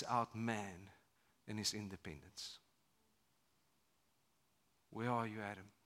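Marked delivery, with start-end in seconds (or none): 4.9-5.7 tender call. Acted. God's call, soft and tender.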